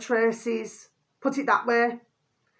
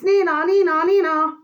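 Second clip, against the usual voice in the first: high, loud sing-song nee-naw of an ambulance siren; cartoon siren noise, hammed up; siren imitation; 9 semitones higher, 7 dB louder than usual. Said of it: "we're listening for ambulances".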